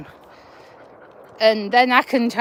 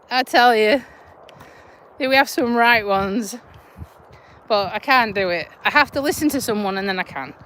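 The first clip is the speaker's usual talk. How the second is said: normal talking voice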